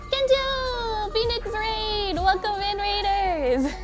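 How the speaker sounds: high, bubbly raid welcome; cute anime streamer. Real, not acted. Streamer greets incoming raid.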